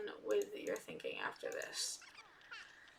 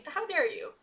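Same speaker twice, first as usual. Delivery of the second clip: 1 mock outrage; 6 semitones higher, 3 dB louder than usual. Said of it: "defending husband, hammed up".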